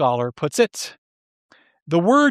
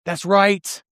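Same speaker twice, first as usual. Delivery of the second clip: lightly voiced, higher, gloating inner cheer; mock gleeful cheer; 5 semitones higher, slower than usual. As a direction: play the self-righteous listener cheering punishment. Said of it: "we cheer deep down in our hearts".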